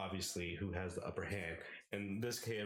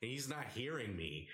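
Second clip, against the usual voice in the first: normal talking voice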